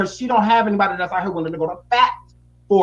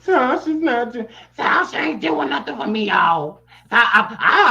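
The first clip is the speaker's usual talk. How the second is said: high, whining mock plea that rises to a fake sob; over-the-top whimpering imitation of a complainer; mocking whiny Wiley; 6 semitones higher than usual. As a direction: mimic him begging pitifully with a wail breaking in. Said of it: "you keep going around on this campaign of".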